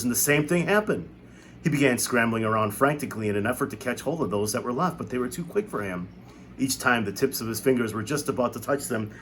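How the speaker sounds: ordinary delivery, nothing marked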